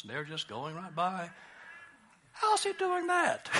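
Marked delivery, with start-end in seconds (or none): 2.3-3.5 high-pitched, drawn-out grumble; hammed-up high, softer disbelief; mock envious wonder. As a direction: whine it slowly, way up high. Voices an envious disciple in boat.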